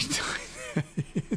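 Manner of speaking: helpless breathy laughing fit; stuttering giggle. Real, not acted. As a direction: crack up mid-sentence. Cracks up saying the nickname.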